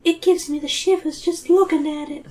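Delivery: high, thin creeped-out voice; small high girlish shudder; Zoe. A narrator's reading. Zoe getting shivers from the statue, creeped out. Zoe's voice in the story.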